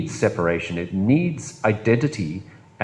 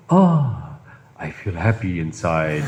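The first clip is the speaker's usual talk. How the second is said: King Julian happy; 4 semitones lower, slower than usual. Retold or imitated. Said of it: "and he's like, gasps".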